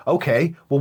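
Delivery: usual speaking voice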